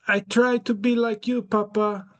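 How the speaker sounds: put-on son confessing to dad in a high European accent; Barron with a European accent. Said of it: "he speaks with a European accent".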